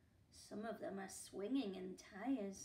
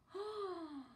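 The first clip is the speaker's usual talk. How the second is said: gasp; 7 semitones higher than usual. Acted. Reader reacts to crown theft.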